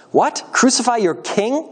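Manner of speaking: high, drawn-out question rising at the end; incredulous. A narrator's reading. Pilate challenges the crowd's demand, incredulous. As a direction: question them in disbelief.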